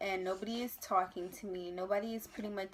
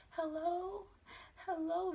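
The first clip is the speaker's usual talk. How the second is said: high, soft, timid calling out; small scared voice; 9 semitones higher, 5 dB quieter, slower than usual. Retold or imitated. Scared patient calling to staff who ignore her, small high timid voice. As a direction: call out weakly, like a scared little sheep. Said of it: "That's literally how I sounded".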